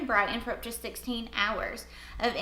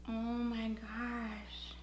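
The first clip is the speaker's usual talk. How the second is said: awed whisper, very slow; 8 dB quieter, much slower than usual. Real, not acted. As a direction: breathe it out in awe. Amazed by concealer result.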